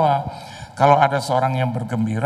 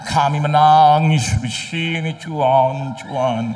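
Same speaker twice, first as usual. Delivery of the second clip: mock tearful prayer; 2 semitones higher, 3 dB louder, slower than usual.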